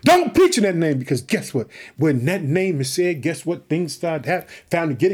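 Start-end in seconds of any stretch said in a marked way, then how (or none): 0.0-1.0 higher, louder stern order; forbidding command. Retold or imitated. Preacher again voicing authorities who forbid preaching, loud stern command. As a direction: shout the ban down at them. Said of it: "They tell them".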